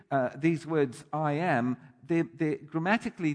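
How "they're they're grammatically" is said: normal talking voice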